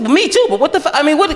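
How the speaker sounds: high, laughing shocked cry; shocked disbelief. Retold or imitated. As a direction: squeal it in shock. Shocked reply.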